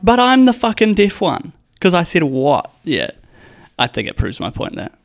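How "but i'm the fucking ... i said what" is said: mocking retort. Mocking girlfriend's logic.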